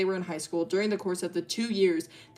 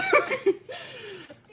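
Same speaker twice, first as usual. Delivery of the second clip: high laugh. Real, not acted. Laugh after joke.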